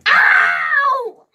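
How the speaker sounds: high, loud yelp; scream. A narrator's reading. Boy tapped on the head, yelping loudly. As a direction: yelp at the tap. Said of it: "the boy screamed again".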